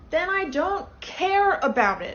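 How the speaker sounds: high, loud and slow, stamping each word; angry outburst. Acted. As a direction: throw a tantrum over no whales. Tourist angry there are no whales.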